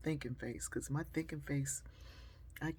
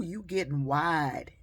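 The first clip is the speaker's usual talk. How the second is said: slow teasing; 8 dB louder, slower than usual. Retold or imitated. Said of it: "the man looks over and goes".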